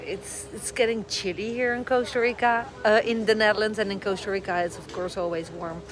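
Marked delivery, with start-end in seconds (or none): none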